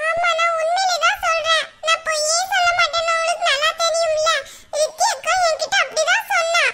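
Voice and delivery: put-on high, bright childlike voice of a small girl; little girl voice. Granddaughter confiding in grandma, high little girl voice. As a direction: play the young granddaughter gossiping eagerly.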